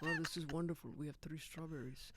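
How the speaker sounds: soft, low put-on awe; mock wonder. Guest humouring the French couple, softly awed. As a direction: humour them in a hushed low voice.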